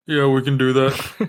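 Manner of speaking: loud, drawn-out character voice; slow it down and push it out loud; character voice try-out. Acted. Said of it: "drop your voice, like, four octaves".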